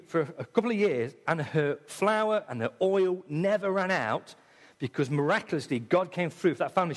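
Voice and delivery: normal talking voice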